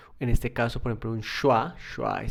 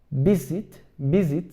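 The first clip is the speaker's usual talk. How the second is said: visit stressed on VIS; VISit, accent up front; stress on first syllable; 3 semitones higher, 4 dB louder, slower than usual. Shown as a demonstration. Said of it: "el acento ya va a ser en la primera".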